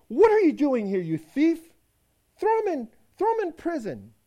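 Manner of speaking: very high, loud angry demand; wife shrieking high and loud at the thief; furious shriek. Acted. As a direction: scream at the thief.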